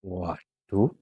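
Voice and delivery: ordinary talk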